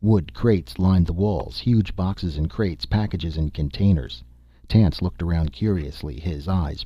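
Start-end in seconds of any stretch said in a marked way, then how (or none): none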